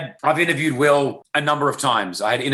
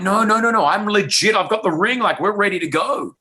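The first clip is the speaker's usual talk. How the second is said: eager insistence; 8 semitones higher than usual. Retold or imitated. Insisting to the publicist it's real.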